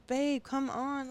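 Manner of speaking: exasperated couple's plea, retold; mock pleading. Coaxing partner to cook in retold spat.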